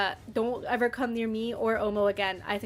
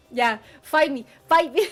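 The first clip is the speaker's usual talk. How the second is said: louder, climbing teasing dare; playful challenge; 3 semitones higher, 8 dB louder than usual. Pretend. Streamer daring chat over her rating, playful loud dare. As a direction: throw down a cheeky dare.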